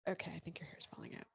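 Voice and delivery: quick soft whisper; whispered admission. Retold or imitated. Hairdresser finally conceding, quick whisper. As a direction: admit it quietly and fast. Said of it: "she goes".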